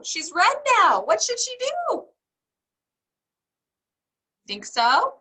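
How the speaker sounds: ordinary delivery, nothing marked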